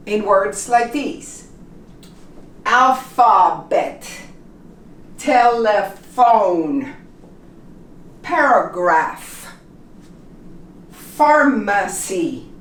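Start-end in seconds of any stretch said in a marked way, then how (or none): 2.7-12.5 slow model reading, F sound in Alphabet, telephone, paragraph, pharmacy. Shown as a demonstration. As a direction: say each word slowly, PH as a clear F. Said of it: "we always pronounce it like an F".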